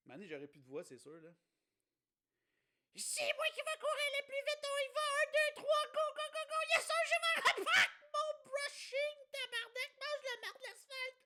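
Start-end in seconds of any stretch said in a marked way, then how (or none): 3.0-11.2 squeaky boastful racer; very high cocky racer voice; silly high voice. Pretend. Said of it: "Je prends ma voix".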